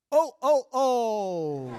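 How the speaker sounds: loud high mock Santa laugh said oh oh oh; hammed-up Santa misreading ho ho ho. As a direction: boom out a wrong Santa greeting. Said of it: "I learned all of my lines".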